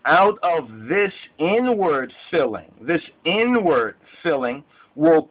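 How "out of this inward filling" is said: usual speaking voice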